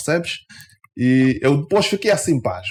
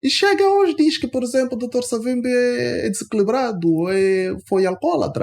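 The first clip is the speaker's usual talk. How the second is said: indignant; 10 semitones higher than usual. Real, not acted. Indignant account of insult.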